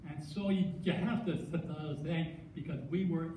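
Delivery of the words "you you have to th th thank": stuttering on the th; catching on the first sound. Real, not acted. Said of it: "and I stutter".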